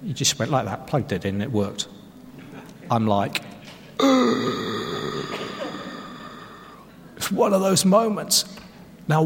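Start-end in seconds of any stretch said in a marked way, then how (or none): 4.0-6.0 high acted gasp; dumbfounded gasp. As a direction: gasp as if shown up by an easy fix. Acts out his dumbfounded reaction.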